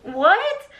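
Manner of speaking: high, stunned what; incredulous what. Retold or imitated. Reacting to his jealousy game, high stunned what. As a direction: disbelief at his nerve, pitched high. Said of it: "And I'm just like".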